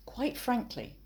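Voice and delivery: high, plummy upper-class "Quite frankly"; posh voice. Shown as a demonstration. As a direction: say "Quite frankly" like a toff reading a letter. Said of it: "did you put on your posh voice like me?".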